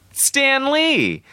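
high excited shout of the name; excited reveal. Pretend. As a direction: sell the fake surprise big. Teasing fake cameo reveal.

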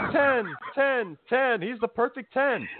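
high-pitched imitation of a yelled chant; hammed-up high crowd chant; mock crowd yell. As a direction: mimic fans yelling, pitch way up. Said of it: "Everybody just yells".

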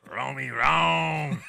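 very slow, laughing character voice. Acted. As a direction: stretch the name like Martin's character. Voicing Martin's character, laughing.

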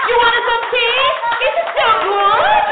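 put-on high, loud, slow old lady voice; grandma fretting. Stingy grandma offering reused tea, loud and high.

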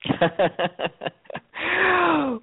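big high burst of laughter; hearty laugh. Real, not acted. Laughs at patois claim.

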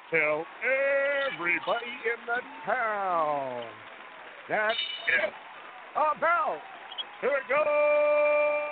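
high, slow, booming arena announcer; fighting game announcer. Pretend. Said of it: "If you could do announcer voice".

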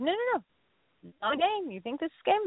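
high, soft mimicked protest; mock disbelief. Coach answers a balking client, high mock disbelief. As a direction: pitch it way up, mockingly incredulous.

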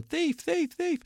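high-pitched mock shout of alarm; imitated shout.

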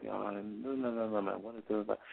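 very soft, half-voiced reading to oneself; under the breath. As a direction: imitate someone murmuring to themselves at a computer. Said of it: "talking kinda under their breath".

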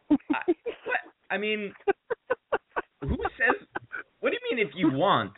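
high-pitched disbelief, stumbling over the words; squeaky mock bewilderment, dropping at the end; retold incredulous sputter. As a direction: replay being baffled by an absurd offer. Retold baffled reply to discount offer.

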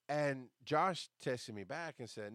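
ordinary talk, nothing marked

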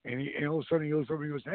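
ordinary delivery

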